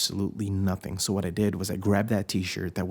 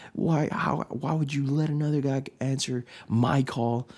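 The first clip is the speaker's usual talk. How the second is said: retold angry rant; 5 semitones higher than usual. Angry texts to girlfriend retold.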